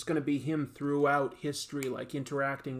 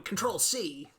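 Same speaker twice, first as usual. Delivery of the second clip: villain catchphrase; 3 semitones higher, slower than usual. Acted. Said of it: "runs around going".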